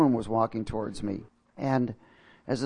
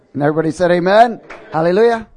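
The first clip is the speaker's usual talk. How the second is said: loud, fast, high hallelujah call; rousing shout; 5 semitones higher, 13 dB louder, faster than usual. Real, not acted. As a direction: lift the whole room with it. Rousing the congregation after prayer.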